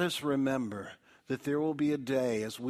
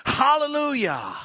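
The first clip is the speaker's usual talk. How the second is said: much higher, louder cry, falling at the end; crying out hallelujah; 12 semitones higher, 10 dB louder than usual. Acted. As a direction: cry it out like a great multitude. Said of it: "crying out".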